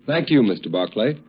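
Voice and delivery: normal talking voice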